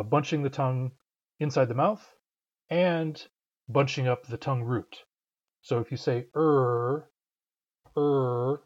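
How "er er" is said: a slow, bunched er; English R. Shown as a demonstration. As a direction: draw out er and feel the tongue root. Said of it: "feel your tongue root flexing".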